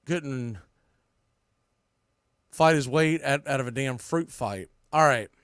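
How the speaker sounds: usual speaking voice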